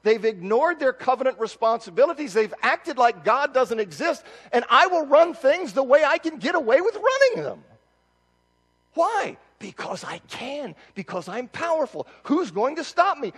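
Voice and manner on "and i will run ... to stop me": arrogant tyrant. Acted. Voicing arrogant powerful oppressors.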